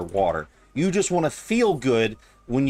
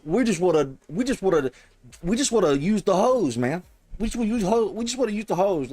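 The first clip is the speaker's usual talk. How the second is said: higher, mocking childish whine; mock whiny; 8 semitones higher than usual. Mocking the Protestants who just want to use the hose, in a higher whine. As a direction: whine it like a brat.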